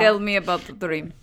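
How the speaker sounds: rough Russian-German accent; badly faked German accent; Sigmund Freud. Shown as a demonstration. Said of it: "That's how Freud talked".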